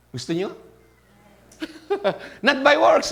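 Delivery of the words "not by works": loud, high emphatic declaration; Not by works, called out loud and high. Real, not acted. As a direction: drive the point home with force. Preacher stresses grace not works.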